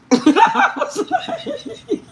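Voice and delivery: words lost in high laughter; real laughter breaking up the line; laughing. Real, not acted. Recalling shocking question.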